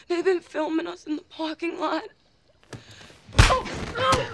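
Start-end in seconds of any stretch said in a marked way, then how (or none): 3.4-4.3 dismayed cry. Acted. She realizes she is caught.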